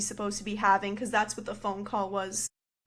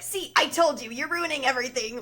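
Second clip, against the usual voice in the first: mock-scolding wife, higher and louder; exasperated wife; 4 semitones higher, 3 dB louder than usual. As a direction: act out an I-told-you-so outburst. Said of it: "the wife is literally like".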